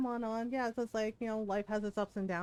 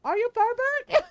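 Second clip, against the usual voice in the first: much higher, louder mock Filipino aunt demand, slowing on the last words; demanding titas; 12 semitones higher, 8 dB louder than usual. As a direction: interrogate him like an outraged Filipino tita. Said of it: "And my titas are like".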